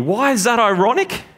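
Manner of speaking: normal talking voice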